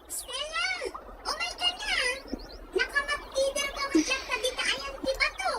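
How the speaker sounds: distant call, high and far off; calling from afar. Acted. Friend calls Stella from far away, distant call. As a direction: call her name across the river.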